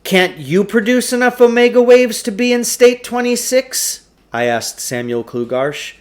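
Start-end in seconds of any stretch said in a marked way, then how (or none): 0.0-4.0 higher, wondering question; curious boy. A narrator's reading. Narrator boy questioning the shopkeeper, higher curious tone. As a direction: ask it like a doubtful kid.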